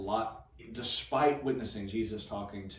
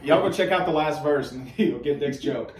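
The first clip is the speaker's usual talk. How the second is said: laughing; 3 semitones higher, 8 dB louder than usual. Real, not acted. Laughing while telling viewers.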